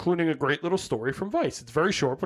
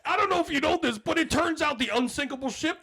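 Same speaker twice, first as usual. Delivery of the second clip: mock nerdy date; 11 semitones higher than usual. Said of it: "you were like".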